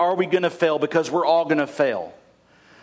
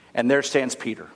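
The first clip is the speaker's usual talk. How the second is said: usual speaking voice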